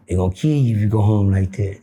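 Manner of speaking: put-on low, serious old man's warning; grave old man. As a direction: drop it deep and grave.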